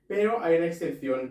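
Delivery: normal talking voice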